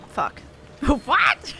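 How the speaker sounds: ordinary delivery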